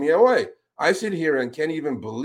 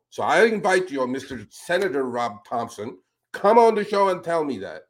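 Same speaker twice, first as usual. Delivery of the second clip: ordinary delivery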